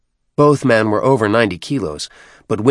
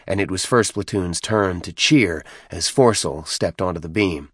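ordinary delivery

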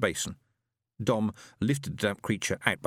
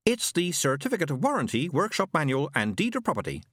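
higher, precise machine voice; pompous robot; 6 semitones higher than usual. A narrator's reading. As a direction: list it like a know-it-all robot. Robot Isaac speaks.

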